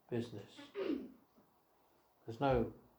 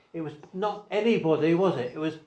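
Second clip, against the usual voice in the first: higher and louder, driving each phrase; preaching at full force; 5 semitones higher, 7 dB louder than usual. Real, not acted. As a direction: build to the climax of the sermon. Preacher rising to climax.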